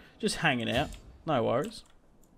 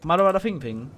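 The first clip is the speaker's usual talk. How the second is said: mock Welsh gibberish; 4 semitones higher, 3 dB louder than usual. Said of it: "That's what you sort of say".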